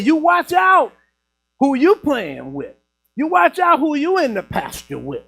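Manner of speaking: high, loud imitation of grandma's warning; hammed-up scolding granny with snuff in her lip; grandmother talking funny. Grandmother warns boy about his playmates, talking funny. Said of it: "make her talk a little funny".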